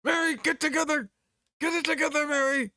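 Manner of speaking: high, loud calling; desperate shout. Acted. Calling out to Mary, hoping she answers, shouting high. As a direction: shout her name to wake her. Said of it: "I call out to her".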